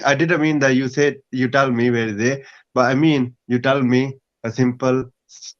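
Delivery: ordinary delivery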